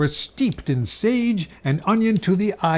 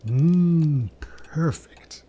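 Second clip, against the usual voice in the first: savoring taste; much slower than usual. Acted. Tasting the jug.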